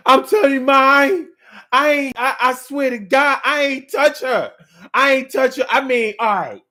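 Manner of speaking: high, loud pleading, put on; mock tearful yelling. Terrence denies it to his girlfriend's mother, mock tearful yelling. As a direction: act out a crying, yelling denial. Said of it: "yelling, screaming, and crying and lying".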